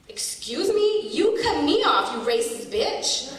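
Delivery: high, slow, mock-outraged comeback; retelling a comeback to a rude driver, high and outraged; indignant retort. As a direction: fire it back at him in disbelief. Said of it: "then I replied".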